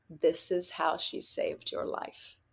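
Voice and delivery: slow near-whisper; hushed revelation. Retold or imitated. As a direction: deliver it softly, with weight. Retold revelation from her mind.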